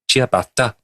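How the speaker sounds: ciabatta said lightly in a Welsh accent; a light Welsh lilt on Ciabatta. Shown as a demonstration. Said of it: "You have to say it in a Welsh accent".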